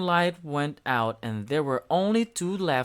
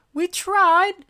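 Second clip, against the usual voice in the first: Smudge voice; 7 dB louder than usual. A narrator's reading. Smudge giving the ball back, high and shy. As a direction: apologise shyly. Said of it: "said Becky".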